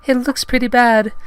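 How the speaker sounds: high, loud falsetto heroine; Padme. Acted. Heroine tending friend's injured tongue, high loud falsetto. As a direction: put on a high feminine falsetto. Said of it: "she said".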